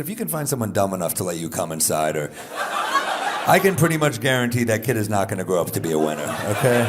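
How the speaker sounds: loud, fast laughing put-down; mock-angry loud retort; loud comeback. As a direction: fire back loudly at him.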